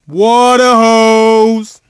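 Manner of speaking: long, stretched-out hype call; drawn-out cheer. Acted. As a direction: stretch out the shout slowly. Trash talk after a made shot.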